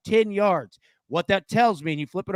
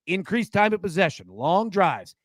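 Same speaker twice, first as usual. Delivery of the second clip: usual speaking voice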